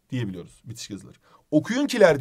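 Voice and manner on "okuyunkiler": "Okuyunkiler" said higher and louder; test word called out. Shown as a demonstration. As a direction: try out "Okuyunkiler" aloud. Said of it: "diyebiliyor muyuz?". (denenen kelimeyi yüksek sesle söyleme)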